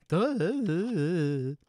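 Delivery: loud, high, wobbling "duh"; mock-dumb duh. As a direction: ham up a long, self-mocking "duh". Hamming up the "duh" for viewers.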